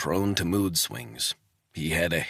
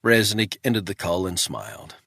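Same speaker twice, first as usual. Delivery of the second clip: plain reading voice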